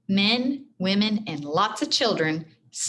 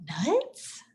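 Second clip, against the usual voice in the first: soft, higher whispered question; whispering; 5 semitones higher, 5 dB quieter than usual. A narrator's reading. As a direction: whisper it in confusion. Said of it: "whispered others".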